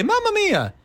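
high cartoon Italian exclamation; Mario voice. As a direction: imitate the cheery game plumber's catchphrase. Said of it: "he would talk like".

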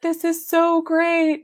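high, drawn-out imitation of overjoyed husband; happy crying husband. Wife imitating husband at the pregnancy test, high and overjoyed. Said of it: "And he's crying".

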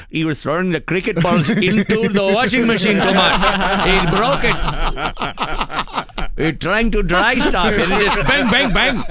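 put-on high Indian accent, laughing through it. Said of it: "Totally racist".

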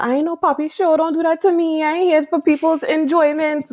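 mock-scold like a Trini mother; Trinidadian accent, playful scolding impression; imitating mom scolding. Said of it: "she just keeps cursing me out".

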